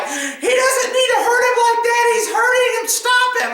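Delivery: high-pitched mock nurse voice; shrieky, hammed-up objection; protesting nurses. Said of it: "A couple of nurses were like".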